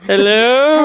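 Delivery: high questioning hello; imitating phone hello. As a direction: call out like on a phone. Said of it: "it's just like".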